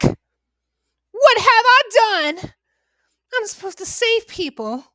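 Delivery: slow, high, drawn-out despair; anguished lament. Acted. The doctor realising his failure, slow anguished cry. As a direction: wail it out slowly in regret.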